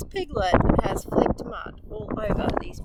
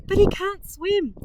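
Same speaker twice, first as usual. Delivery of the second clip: higher, alarmed mother; worried cry; 10 semitones higher than usual. A narrator's reading. Mother Pig fearing her piglet will drown, mild alarm. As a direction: fear for her child breaking through. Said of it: "cried Mother Pig".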